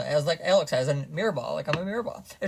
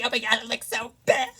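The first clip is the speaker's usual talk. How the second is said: higher mock disgust; mock catty critic; 8 semitones higher, 4 dB louder than usual. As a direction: mimic a snide critic.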